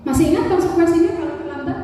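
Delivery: quick checking question; a light teacher reminder; monitoring teacher. Shown as a demonstration. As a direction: remind the student of the rules briskly. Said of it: "Kemudian pemantau".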